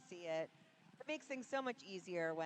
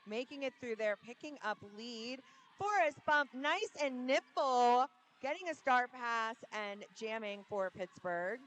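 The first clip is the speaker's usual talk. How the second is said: live play-by-play; 4 semitones higher, 8 dB louder than usual. Real, not acted. Announcer calls a star pass for Pittsburgh, excited play-by-play. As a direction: call swelling louder and higher midway.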